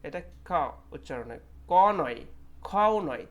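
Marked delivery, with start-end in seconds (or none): 1.7-3.2 ক and খ said sharply and loudly, to set them apart; not ক, not খ. Shown as a demonstration. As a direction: stress ক and then খ as the two wrong extremes. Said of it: "তার ভিতরে মাঝখানে একটা কর পরে". (জোরে ক আর খ আলাদা করে বলা)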